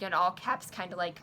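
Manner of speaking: normal talking voice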